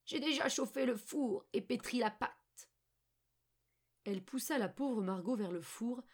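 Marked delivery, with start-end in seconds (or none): 0.1-2.7 put-on old witch. A narrator's reading. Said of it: "dit la vieille".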